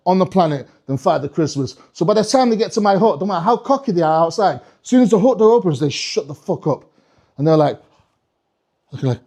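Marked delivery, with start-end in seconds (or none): none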